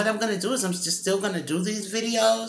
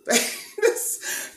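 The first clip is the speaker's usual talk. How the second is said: high burst of laughter on the word; real squealing laugh through the word; 4 dB louder than usual. Real, not acted. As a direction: break up laughing as you start to speak. Laughs at her three-day workload.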